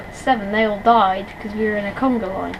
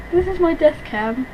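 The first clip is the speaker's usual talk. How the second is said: normal talking voice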